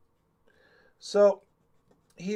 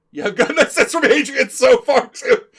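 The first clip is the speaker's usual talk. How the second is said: loud, high, through laughter; laughing aside; 9 semitones higher, 13 dB louder than usual. Real, not acted. Teasing a friend about Adrian, loud laughing aside. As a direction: blurt it out while cracking up.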